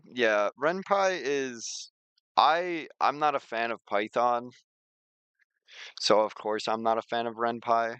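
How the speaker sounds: usual speaking voice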